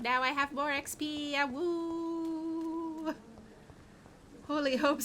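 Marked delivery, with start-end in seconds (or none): 1.4-3.1 high drawn-out wolf howl. Pretend. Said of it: "That's a sound that vampires make".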